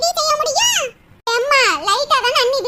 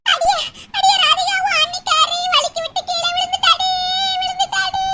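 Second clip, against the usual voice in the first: panicked wailing; 6 semitones higher than usual. Acted. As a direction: wail the bad news to your daughter. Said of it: "ஏன் இப்படி அலறிக்கிட்டு கிடக்க".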